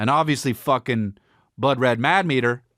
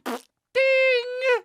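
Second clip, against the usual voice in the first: high sung-out ding like a phone alert; imitated phone ding. Friend's phone going off, imitated as a high ding.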